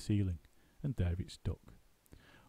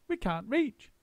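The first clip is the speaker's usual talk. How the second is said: fretful monkeys; 13 semitones higher, slower than usual. A narrator's reading. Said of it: "they asked".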